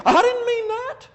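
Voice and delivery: very high-pitched mock protest; squeaky-high acted denial; mock indignant protest. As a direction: play the defensive objector, voice leaping up. Acted protest in imagined argument.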